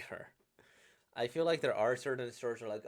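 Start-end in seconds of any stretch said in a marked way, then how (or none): none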